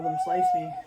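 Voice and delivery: sudden high squeal of panic; panicked cry. Retold or imitated. Mother retelling her C-section, squealing in panic. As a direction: voice jumps way up in mock terror.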